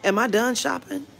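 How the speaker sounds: loud, high sarcastic question; petty loud aside to her friend; sarcastic quip. Retold or imitated. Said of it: "I turned to Jamila like".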